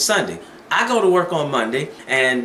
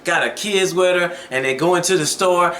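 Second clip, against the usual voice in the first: usual speaking voice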